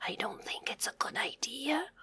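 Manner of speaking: soft unvoiced whisper. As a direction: whisper it to your friend. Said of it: "whispered the tiny bear".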